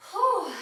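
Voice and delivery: loud, high exhaled "whew"; big whew after exertion; relieved whew. Real, not acted. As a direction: blow out relief after hard effort. Relief after holding plank.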